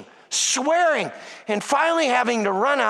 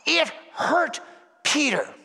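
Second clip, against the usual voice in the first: ordinary talk, nothing marked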